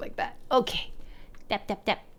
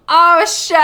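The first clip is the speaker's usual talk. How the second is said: higher, louder second oh shit; louder retake; 8 semitones higher, 9 dB louder than usual. Shown as a demonstration. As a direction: redo the curse with more punch.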